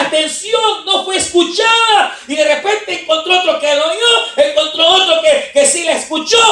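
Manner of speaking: loud, high preacher's cry; fiery preaching. Acted. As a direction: preach it out to the hall. Preaching at full force. (predicación a todo pulmón)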